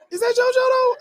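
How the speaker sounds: very high laughing question; laughing disbelief. Retold or imitated. Retelling the twist to viewers, high laughing disbelief. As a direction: squeal it through a laugh.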